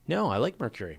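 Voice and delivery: ordinary talk, nothing marked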